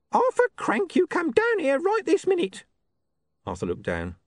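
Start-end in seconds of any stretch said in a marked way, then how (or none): 0.1-2.6 very high screechy nag; scolding wife. A narrator's reading. Wife orders husband down from the roof, high scolding call. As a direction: holler up at your husband, high-pitched.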